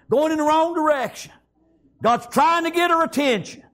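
high, rallying pulpit delivery; preaching. Real, not acted. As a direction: drive the refrain upward like a revival preacher. Preaches at full force.

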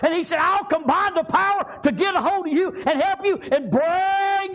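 high shouted preaching. Acted. Preacher telling the church they have a personal God, shouted. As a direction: lift it up and shout it out. Preacher quoting God's promise.

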